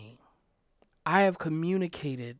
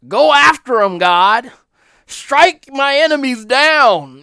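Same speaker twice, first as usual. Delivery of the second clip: much louder and higher, slow on the last words; loud vengeful prayer; fervent cry to God; 8 semitones higher, 15 dB louder than usual. Retold or imitated. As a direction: call down vengeance like a psalmist. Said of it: "where David said".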